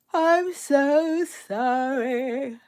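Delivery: said through a yawn; stretched slow yawn. Real, not acted. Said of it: "We're yawning".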